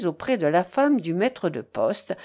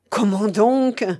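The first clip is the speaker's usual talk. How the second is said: louder, drawn-out scoff; disdainful exclamation; 3 semitones higher, 6 dB louder, slower than usual. A narrator's reading. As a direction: wave the question away with mock surprise. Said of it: "s'écria Vernier en faisant un geste de dédain".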